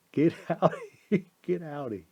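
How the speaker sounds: laughing while shooing the cat; laughing scold. Real, not acted. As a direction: shoo the cat off, half laughing.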